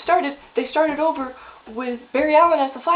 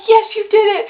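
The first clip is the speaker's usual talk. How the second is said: mock cheer; 6 semitones higher, 5 dB louder than usual. Retells everyone cheering the success.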